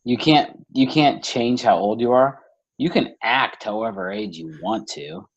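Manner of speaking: normal talking voice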